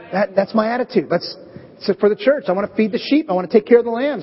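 ordinary talk, nothing marked

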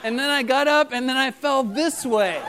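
lightly voiced little boy insisting on his story; imitating fibbing child. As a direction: keep building the kid's made-up excuse. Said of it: "he's like".

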